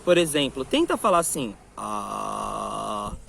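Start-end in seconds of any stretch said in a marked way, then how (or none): 1.8-3.1 very low, long level "ah"; deep sustained "ah", vocal cords vibrating; lowest pitch. Shown as a demonstration. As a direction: drop to the bottom of your range and hold "ah". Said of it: "nosso tom mais baixo".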